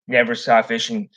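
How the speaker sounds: ordinary talk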